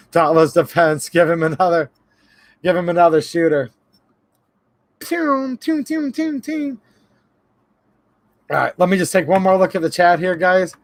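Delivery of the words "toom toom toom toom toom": high mock shooting noises toom toom; playful high shooting sound effects; imitated gun shots.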